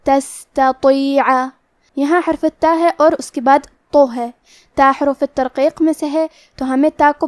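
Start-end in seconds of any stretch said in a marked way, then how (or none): 0.1-1.2 تستطیع very slow, with the س whispered with air; breathy sin. Shown as a demonstration. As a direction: hams demo. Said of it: "سین کو ایسے پڑھیں گے".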